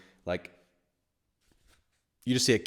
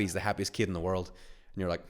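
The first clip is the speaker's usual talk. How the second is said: usual speaking voice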